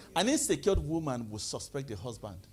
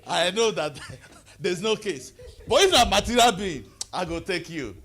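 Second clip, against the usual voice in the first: laughing through the words; 6 dB louder than usual. Real, not acted. Joking to the congregation, laughing. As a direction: chuckle while telling it.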